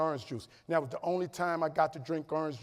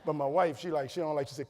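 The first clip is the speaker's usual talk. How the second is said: ordinary talk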